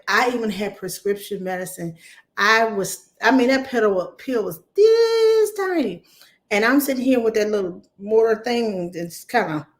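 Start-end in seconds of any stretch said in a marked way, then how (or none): 4.8-6.0 high squeaked "this tiny"; mock tiny voice; squeaky tiny. Real, not acted. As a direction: squeeze the voice up small and slow. Exaggerating tiny pill.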